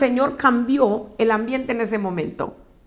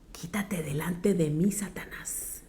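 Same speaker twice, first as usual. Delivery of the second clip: hushed, stern whisper; whispered rebuke; 3 semitones lower, 10 dB quieter than usual. Acted. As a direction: rebuke Satan in a low whisper. Jesús reprende a Pedro. (reprensión en susurro)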